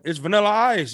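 high, proud self-announcement. Retold or imitated. Star revealing his name to a stunned caller, high and proud. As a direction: drop the name like it should impress. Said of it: "So then he said".

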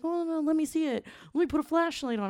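high, hurried imitation of a fretting boyfriend; acted concern, high and insistent; worried boyfriend. Voicing worried boyfriend offering help.

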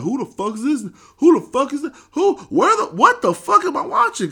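mock bewildered yelling; baffled. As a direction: shout in disbelief at the screen. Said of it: "it's like".

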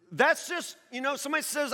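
normal talking voice

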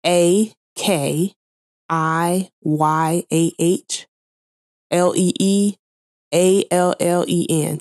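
letter by letter, slow and careful; spelling it out. Shown as a demonstration. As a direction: spell each letter clearly. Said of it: "let me spell it for you".

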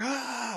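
high mock "Ah" of pain; fake pained cry. Player showing how to fake being wounded, high mock pained cry. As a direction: pretend a wound with a high moan. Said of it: "So I could just be like".

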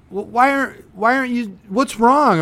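high, loud, prying mock whine; nosy acquaintance. Imitating a pushy guest who won't let you leave. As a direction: mimic someone pressing you for why you leave. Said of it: "And they".

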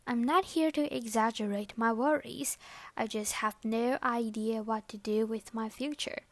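normal talking voice

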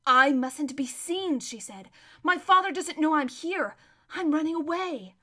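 tense confession; urgent. Acted. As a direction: impress the danger on her friend. Runaway girl urgently confides.

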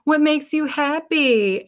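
high, slow and bright-eyed; cheery high voice. Pretend. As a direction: ask yourself sweetly, up high.

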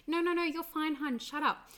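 put-on high, brushing-off parent hushing a crying child; dismissive hushing. Parent hushing a screaming child, high and brushing-off. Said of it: "trying to shut your child up".

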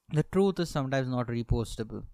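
normal talking voice

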